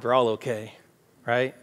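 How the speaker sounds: normal talking voice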